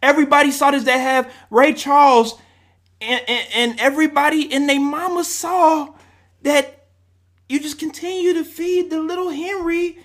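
high, rising, exasperated rant; emotional rant. Real, not acted. Fan vents about the play calling, exasperated and rising. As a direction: let it boil up into a yell. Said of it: "I'm out of character right now".

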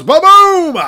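loud, high ba-boom punchline; mock rimshot. Comic selling his own joke with a loud, high rimshot.

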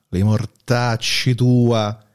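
ordinary talk, nothing marked

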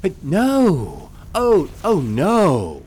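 high, loud, slowed alarmed denial; much alarmed. Acted. Narrator hastily denies calling the angel a chicken, much alarmed. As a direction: back off in a hurry, high and loud. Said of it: "I replied, much alarmed".

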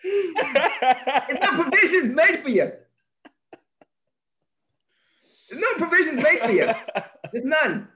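high, slow, laughing through it. Real, not acted. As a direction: drawl it out while laughing.

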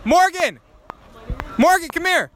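shouting a name across a crowd; calling out. Real, not acted. Spotting a friend across venue.

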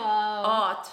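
drawn-out "art" without its R; "art" as said with a childhood speech impediment, R dropped. Retold or imitated. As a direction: stretch "art" into "awt". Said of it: "I couldn't say art with, like, the R".

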